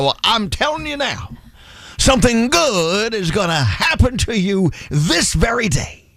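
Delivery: a hint of a Sunday TV preacher; lightly imitating an old televangelist. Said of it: "He would come out every Sunday morning and look at the camera and go".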